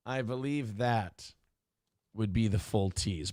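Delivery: normal talking voice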